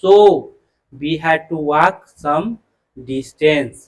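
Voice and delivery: very slow, word by word reading; slow dictation. Shown as a demonstration. Teacher reading the corrected sentence for the class, slow and deliberate. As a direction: drag each word so students follow.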